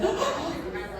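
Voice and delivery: soft, high gasp of alarm; mock gasp. Foot fetishist as her shoe comes off, mock gasp. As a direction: gasp as if stopping someone just in time.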